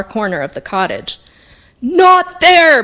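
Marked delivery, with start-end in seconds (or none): 1.8-2.8 loud, high-pitched angry shout; sudden forceful outburst; bellow. A narrator's reading. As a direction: bellow a sharp warning. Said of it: "bellowed the woodcarver".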